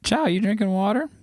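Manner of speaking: high-pitched cooing question to a pet; pet talk. Real, not acted. Streamer checking on Chow drinking water, high pet-talk voice.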